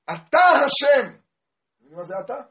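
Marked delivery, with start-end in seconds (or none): 0.0-1.1 high, loud, drawn-out shout; crying out to God. Acted. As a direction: cry it out with full force. Climactic cry to God. (זעקה אל השם)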